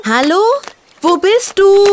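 loud, high call into the woods; calling out loudly. Acted. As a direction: call out through the forest. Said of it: "Ferdinand rief lautstark in den Wald hinein".

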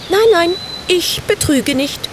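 high, eager reassuring witch; assuring little witch. A narrator's reading. Samantha assures the bird she won't cheat, high and eager. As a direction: protest innocence in a high girlish voice. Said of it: "versicherte Samantha".

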